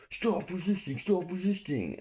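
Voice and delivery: high, strained mock cop shout; imitating officer's yell. Cop wrestling the suspect, high imitated yell. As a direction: mimic a frantic cop barking orders.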